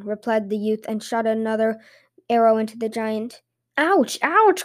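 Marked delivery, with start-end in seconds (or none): 3.8-4.6 high-pitched yelp of pain; pained cry. A narrator's reading. Giant hit by the shepherd's arrow, pained high cry. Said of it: "cried Stumpilt".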